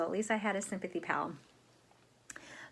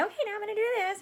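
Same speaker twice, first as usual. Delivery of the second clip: much higher, a bit louder sing-song announcement; imitating what the dentist should have said; mock dentist; 13 semitones higher, 5 dB louder, much faster than usual. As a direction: pretend to warn the patient in a chirpy high voice. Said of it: "She didn't even say".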